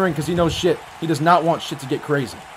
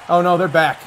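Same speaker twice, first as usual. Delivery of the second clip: ordinary talk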